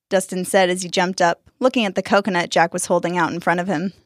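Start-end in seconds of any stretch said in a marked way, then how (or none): none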